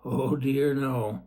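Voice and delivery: ordinary delivery, nothing marked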